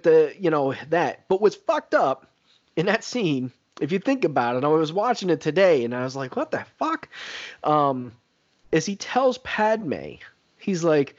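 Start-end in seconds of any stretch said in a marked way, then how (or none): 6.3-7.1 stunned disbelief. Retold or imitated. Reacting to movie scene, I was like.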